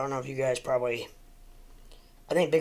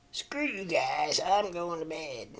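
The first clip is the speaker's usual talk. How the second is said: slow, higher, drawn-out bratty cartoon-kid whine; Cartman impression; 4 semitones higher, 4 dB quieter, much slower than usual. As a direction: stretch each word like a spoiled cartoon kid storming off. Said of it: "That's my best, uh, Cartman impersonation".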